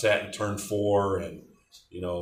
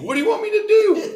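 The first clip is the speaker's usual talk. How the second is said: very high, loud protest; hammed-up shriek at the roommate; retold exasperated yell; 8 dB louder than usual. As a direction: yell back in mock exasperation. Retold exasperated reply to Tony.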